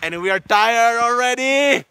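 high, drawn-out shout; loud complaining wail. Real, not acted. Telling Oscar they are already tired, loud wailing complaint. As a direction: holler it out slowly in comic protest. Mock complaint about the climb.

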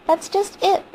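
high-pitched, agitated insistence; upset wife insisting; emphatic agreement. Acted. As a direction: seize on his point, voice jumping up. Wife seizes on his point.

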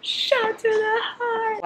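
very high, very slow sung-out swoon. Pretend. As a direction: melt as you stretch it out. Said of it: "swooning over book ending".

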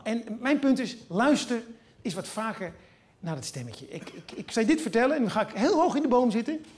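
normal talking voice

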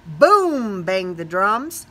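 ordinary delivery

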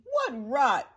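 loud, scornful scolding; shouting. A narrator's reading. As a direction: shout them down as bossy monkeys. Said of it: "The Wickersham brothers were shouting".

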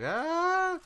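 high yelp mocking a teammate's panic. Imitating a teammate's panicked yelp. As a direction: squeal it out like a startled player. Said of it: "You're just like".

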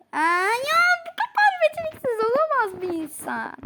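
high, loud exasperated cry; frustrated shriek. Real, not acted. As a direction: wail at your own clumsiness. Dies in game, frustrated. (sinirli çığlık)

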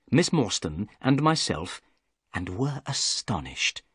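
ordinary delivery

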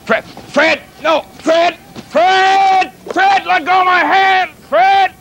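high, loud yelps of protest; pained alarm. Acted. Captain begs his animal to let go of his hand, pained high yelling. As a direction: cry out with the hand being crushed. Hand being crushed in handshake.

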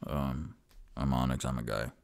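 low, quiet character voice; laconic Onyx. Acted. Customer introduces himself to the bookseller, low and laconic. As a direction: drop deep and say it softly. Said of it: "And Onyx goes".

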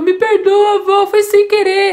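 high, loud crying apology; child sobbing an apology to grandma; tearful plea. Acted. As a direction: beg forgiveness through tears. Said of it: "a criança começou a chorar e falou".

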